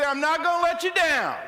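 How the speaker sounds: loud, fired-up promise to a partner; rally your partner, full voice; pumped-up pledge. Shown as a demonstration. Speaker to attitude partner, a loud fired-up pledge. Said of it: "look at your attitude partner and say".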